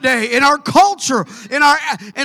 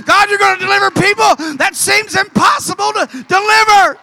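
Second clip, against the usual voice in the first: higher, louder, shouted praise; preaching at full force; 7 semitones higher, 4 dB louder than usual. Acted. Preacher shouting his faith at the devil, full force. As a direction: cry it out to the Lord with fire.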